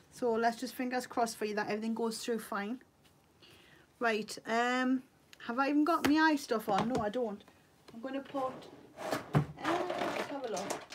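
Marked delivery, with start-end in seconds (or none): none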